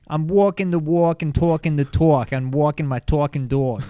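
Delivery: loud, exaggerated New York accent; Coffee Talk accent. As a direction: belt it out with thick New York "aw" vowels. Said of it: "Do coffee talk".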